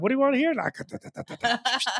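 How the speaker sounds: high hype call then whispered beat; mock DJ hype. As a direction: show off like a cocky karaoke DJ.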